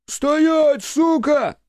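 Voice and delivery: very high, furious pursuer's shout; high-pitched aggressive yell; shouted threat. Acted. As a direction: shout it at someone getting away. Pursuing marauders shout at fugitives.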